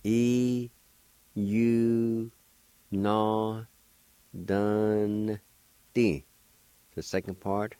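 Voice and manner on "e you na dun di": each syllable held out alone, with pauses; drawn-out teaching demo; syllable-by-syllable pronunciation. Shown as a demonstration. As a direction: sound it out slowly, part by part. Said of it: "pronounce the N sound with the D V like this".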